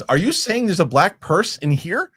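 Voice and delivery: high, slow mock disbelief; slow incredulous question. Host imitating the coworker's slow disbelief about the purse. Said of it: "And he goes".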